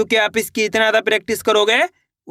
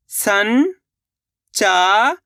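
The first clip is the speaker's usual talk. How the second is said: संचार stretched very slowly, rising at the end; slow drawn-out reading; much slower than usual. Shown as a demonstration. Teacher sounding out संचार for pupils, very slow and rising. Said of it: "शब्द को आपको खुद से पढ़ना है".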